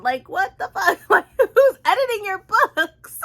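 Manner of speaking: high, loud, laughing outrage; incredulous laughing rant; laughing disbelief. Real, not acted. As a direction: cackle through the disbelief. Exasperated rant at the author.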